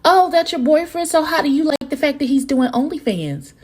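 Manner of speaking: high mocking messy quote; messy Carl. Imagining Carl stirring drama at the party, high and mocking. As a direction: needle them with a nosy question. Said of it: "be like".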